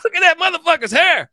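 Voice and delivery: loud, high mocking fan voice; imitating heckling fans. Older fans behind him jeering at the player, loud high mock voice. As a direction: bark it like a rowdy fan in the stands. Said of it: "They're like".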